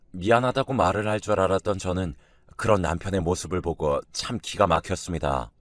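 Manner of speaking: ordinary delivery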